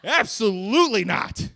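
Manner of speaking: very high, loud, slow outraged cry; grandma recoiling in horror; shrieking grandma. Retold or imitated. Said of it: "And she went".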